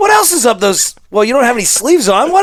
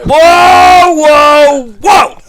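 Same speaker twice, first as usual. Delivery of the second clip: excited shouting; 8 semitones higher, 8 dB louder, much slower than usual. Real, not acted. Stunned hosts react to news.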